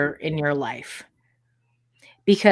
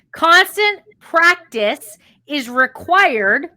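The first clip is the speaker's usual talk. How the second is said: slow, higher-pitched, emphatic dictation; 5 semitones higher, slower than usual. Real, not acted. Coach dictating a key line for listeners to write down, slow and emphatic. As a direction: spell it out slowly for note-takers.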